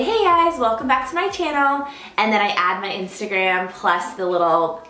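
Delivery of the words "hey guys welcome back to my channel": bubbly intro greeting. Retold or imitated. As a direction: greet viewers with big energy. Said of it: "I say".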